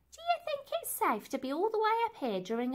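worried high lady. Acted.

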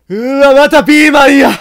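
loud, high cry of dismay; exaggerated horrified wail; dismayed yelp. Real, not acted. Groan at more enemies.

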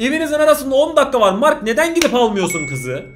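loud, fast exasperated shout at Mark; frustrated yelling. Real, not acted. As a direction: yell at him to go get her. Yells at Mark to fetch girl. (Mark'a bağırarak sitem)

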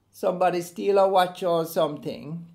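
lightly voiced Errol, casual and dismissive; Errol's voice. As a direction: toss it off like a casual colleague. Errol, a Jamaican colleague, answers.

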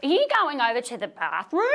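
mock Australian accent with an upward inflection; bad Australian accent. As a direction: imitate someone fumbling an Australian accent. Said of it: "do my impersonation of a person trying to do an Australian accent".

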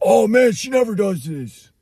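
high, loud mock-apologetic exclamation; imitated dismayed owner. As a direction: play an alarmed owner making excuses for the dog. Retold owner excusing biting dog.

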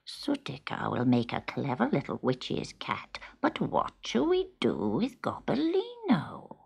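a witch muttering her plans; scheming witch. Acted. The witch talks to the mother.

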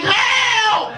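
very high shriek; mock scream. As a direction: shriek as if hit by a taser. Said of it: "He was like".

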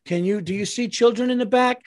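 a disbelieving parent telling the clerk off; exasperated outburst. Retold or imitated. Father wants to scold clerk for scaring his kids, incredulous. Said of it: "I wanted to look at her and go".

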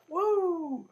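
long, high "woo"; excited whoop. Real, not acted. Player after an epic jump, high excited whoop. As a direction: let out a held, high-pitched whoop.

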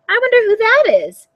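lightly voiced old Baba, sweet and welcoming; a hint of a delighted old granny at the door; old woman's voice. A narrator's reading. Old woman greeting her guest at the door, sweetly.

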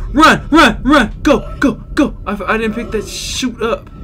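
frantic, high, panicked shouting; being chased. Real, not acted. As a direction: yell it while running for your life. Racing a timer while being chased.

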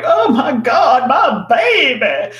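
put-on slow, high, loud wail for her baby; a mother on the bank shouting in terror; panicked mother's shout. Mother on the riverbank screaming for her drowning boy.

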